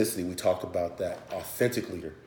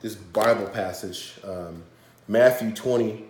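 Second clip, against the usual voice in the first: ordinary talk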